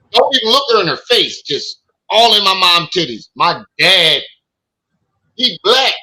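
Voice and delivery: high, loud, worked-up retelling; hyped storytelling. Real, not acted. Telling stream about the lawyer ogling mom, hyped high and loud. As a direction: tell it like it's the funniest thing ever.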